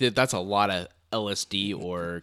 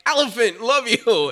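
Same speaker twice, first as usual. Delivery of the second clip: tripping worshipper; 13 semitones higher, 11 dB louder, slower than usual. Retold or imitated. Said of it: "they're like".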